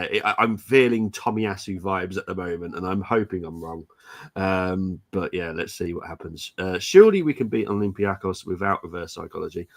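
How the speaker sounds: ordinary delivery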